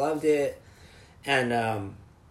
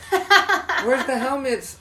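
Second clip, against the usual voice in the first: high, loud, slow cartoon whine; exaggerated cartoon intonation; 11 semitones higher, 6 dB louder, much slower than usual. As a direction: stretch it out mockingly, laughing. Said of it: "he's like".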